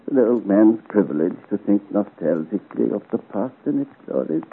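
ordinary delivery

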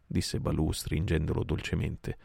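usual speaking voice

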